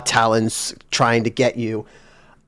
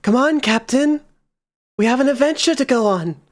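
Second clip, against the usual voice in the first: much higher, quick eager call; high coaxing voice; 11 semitones higher, faster than usual. Acted. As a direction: lure the captain in, high and bright. GM voices a new temple voice.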